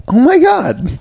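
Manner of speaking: clutch your pearls at the word; scandalised listener reacting to a come-on; shocked gasp. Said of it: "people would be like".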